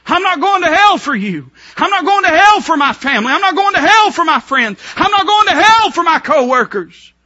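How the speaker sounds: high, loud, each "hell" hammered; defiant shout. Pretend. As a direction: shout it like an unbreakable vow. Sinner's defiant vow, repeated.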